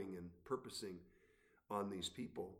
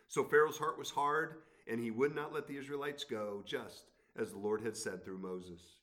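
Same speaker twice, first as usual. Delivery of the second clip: lifted, measured Bible reading; scripture reading; 2 semitones higher, 6 dB louder than usual. Acted. Pastor reads Exodus to the congregation, raised reading voice. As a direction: read God's words to Pharaoh with weight.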